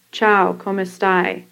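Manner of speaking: American-accented "Ciao, come stai?" with the vowels cut off; flat American reading of "Ciao, come stai?", no Italian melody; typical American accent. Shown as a demonstration. Teacher imitating an American unused to Italian, American accent on "Ciao, come stai?". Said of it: "the way a typical American would say it".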